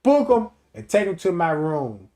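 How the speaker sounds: bossy order. Retold or imitated.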